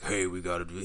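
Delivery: mild gruff tough-guy voice; a hint of a menacing worker; lightly voiced tough guy; imagined deep voice. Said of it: "I'm waiting for this deep voice".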